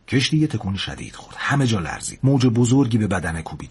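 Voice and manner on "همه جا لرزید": plain reading voice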